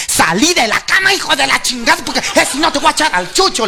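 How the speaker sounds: wife's retold scolding, pitched high; mock yell. Wife ordering husband out from under the bed, mock yelling. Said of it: "gritándole".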